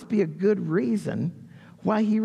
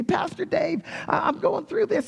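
higher, a hint of desperate pleading; pleading church member; 10 semitones higher than usual. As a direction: pour your heart out to the pastor, lightly voiced. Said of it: "And you pour out your heart".

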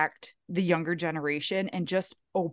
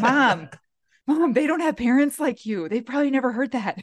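mock daughter; 4 semitones higher, 4 dB louder than usual. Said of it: "I'm like".

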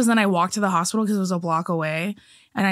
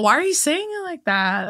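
incredulous daughter; 7 semitones higher, slower than usual. Retold or imitated. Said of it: "I'm all".

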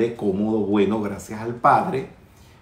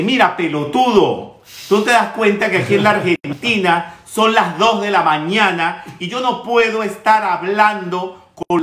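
louder, higher indignant rant; changed, scolding tone; 6 semitones higher, 5 dB louder than usual. Retold or imitated. Grumbler telling off the caller at two in the morning, indignant. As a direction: tell them off in one loud, rushing tirade. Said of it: "cambié mi tono de voz y le dije".

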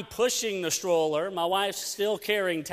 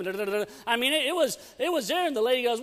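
ordinary talk, nothing marked

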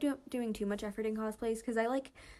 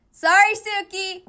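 very loud, higher yell through cupped hands; full shout; 9 semitones higher, 16 dB louder than usual. Acted. Suki shouting across a crowd to find Tadashi, full shout.

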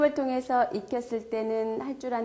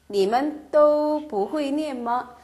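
each syllable spelled out slowly; slow model reading; 4 semitones higher, 4 dB louder, much slower than usual. Shown as a demonstration. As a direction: read it slowly for learners. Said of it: "반말, 你们". (천천히 또박또박 읽는 예문)

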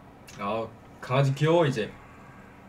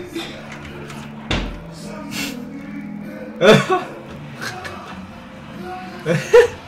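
trying not to laugh; 7 semitones higher than usual. Real, not acted. Laughing during challenge.

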